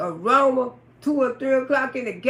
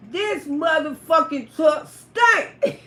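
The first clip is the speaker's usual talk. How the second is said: slow loud, spelling out the stench; slow emphatic; 3 semitones higher, 4 dB louder, slower than usual. Real, not acted. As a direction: drag it out in disgust. Stressing the stench.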